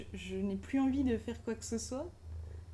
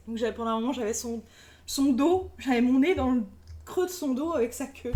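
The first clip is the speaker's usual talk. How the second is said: normal talking voice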